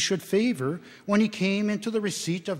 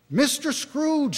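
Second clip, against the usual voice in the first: toasting; 11 semitones higher, 4 dB louder than usual. Acted. Bob proposes a toast.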